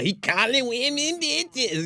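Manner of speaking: high, slow mock accuser; lightly voiced mocking commenter; mocking chat voice. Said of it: "'cause then y'all".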